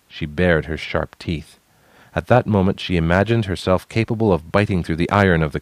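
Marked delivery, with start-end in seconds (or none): none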